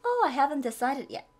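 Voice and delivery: tone it down; milder regret. Shown as a demonstration. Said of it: "이렇게 표현하시면". (차분하게 아쉬움)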